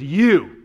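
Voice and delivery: "you" punched out loud; stress on YOU. Shown as a demonstration. Preacher showing the first word in Greek, loud stress on "you". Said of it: "when we emphasize a word".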